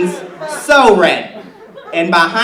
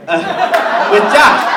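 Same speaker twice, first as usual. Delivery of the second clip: high, loud startled fix of the name; flustered correction; 9 semitones higher, 6 dB louder, much slower than usual. Acted. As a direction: catch the slip and blurt it out. Flustered correction after name slip.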